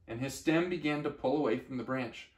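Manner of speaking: ordinary delivery, nothing marked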